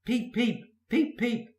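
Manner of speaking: high whistle-like peeps; whistled peeps. A narrator's reading. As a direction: toot the peeps like an engine whistle. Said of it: "whistled Henry".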